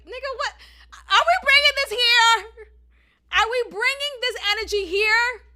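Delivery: loud, high, disbelieving push back; incredulous tone. Retold or imitated. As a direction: throw it back at him, offended. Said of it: "I said it in that kind of tone".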